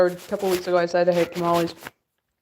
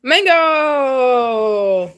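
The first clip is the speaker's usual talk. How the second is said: loud, higher, drawn-out call; 5 semitones higher, 7 dB louder than usual. Real, not acted. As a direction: call the cat across the house. Calling the cat.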